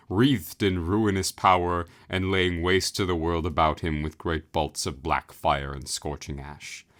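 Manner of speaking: usual speaking voice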